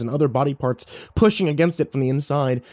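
ordinary delivery

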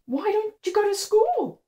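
put-on young girl voice. A narrator's reading. Sophie asking the trash-carrying girl, high girlish voice. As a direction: ask like a puzzled little girl.

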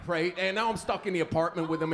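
ordinary talk

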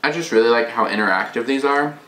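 chewing while talking; eating during speech; mouth full of salad. Real, not acted. Seen 1: Bite taken at -1.0; jaw and lips working while speaking.

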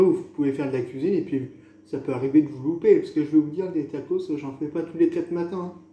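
ordinary talk, nothing marked

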